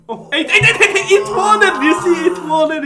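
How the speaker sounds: very high, loud, laughing shout of triumph; ecstatic shouting. Real, not acted. Streamer screams in triumph as eight wins, very high and loud. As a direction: scream with joy that the number hit. Number eight hits, celebrating.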